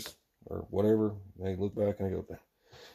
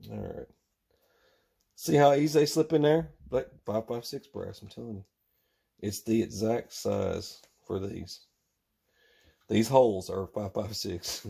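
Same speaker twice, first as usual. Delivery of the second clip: normal talking voice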